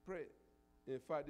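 ordinary talk, nothing marked

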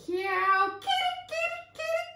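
piercing high bird calls; toucan calls. A narrator's reading. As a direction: screech out loud tropical bird cries. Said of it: "came the calls from the forest crown".

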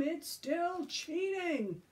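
high, slow, drawn-out protest; shouting. A narrator's reading. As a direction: shout it, stretching every word. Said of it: "shouted Tom".

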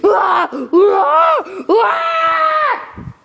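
high rising panicked screams; short panicked yelps climbing high. Real, not acted. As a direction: shriek out in fright at the monster. Panicked screaming at game monster.

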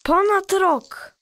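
child reacting to how long they stayed in the ark; amazed. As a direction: stretch it out in disbelief.

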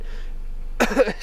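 dry coughs mid-sentence; coughing fit. Real, not acted. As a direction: cough it out, clearing the throat.

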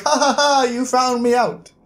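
high mock-villain laugh, then taunting; lightly voiced smug queen cackling at her captor; gloating villain queen. Queen caught with gun on Jazz, mock gloating.

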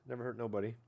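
ordinary talk, nothing marked

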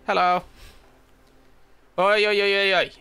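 loud calling "oi". Real, not acted. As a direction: hail someone across the way. Calling out to a stranger.

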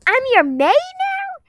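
high, loud, slow indignant question. Acted. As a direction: hurl it back, offended. Offended at being called maid.

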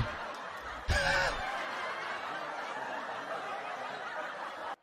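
long, breathless soft laughter; helpless quiet laughing fit. Real, not acted. Laughs at a viewer's comment.

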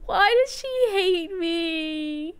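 high, hammed-up fake sobbing; mock crying. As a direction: whine like you're about to cry. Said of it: "I'm gonna cry".

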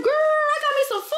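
thrilled to find her snack; excited squeal. Real, not acted. Telling viewers she found food, delighted squeal.

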